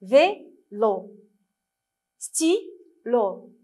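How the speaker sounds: "Vélo, stylo" as VÉ, LO, STY, LO with gaps; syllable by syllable. Shown as a demonstration. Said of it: "avec moi encore".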